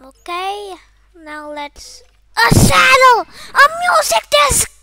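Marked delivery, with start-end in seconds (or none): 2.4-4.7 loud, high thrilled cries; excited shouts. Real, not acted. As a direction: cheer over the loot. Finding loot in chest.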